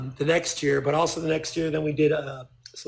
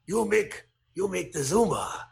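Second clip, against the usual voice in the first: put-on Japanese accent, halting; 2 semitones higher than usual. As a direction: imitate a Japanese businessman's English. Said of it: "here's my Japanese accent".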